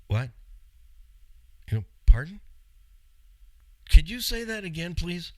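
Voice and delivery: loud, high, stunned pardon; can't believe what he just heard; shocked disbelief. Retold or imitated. Patient stunned by dentist's price, asking again in disbelief.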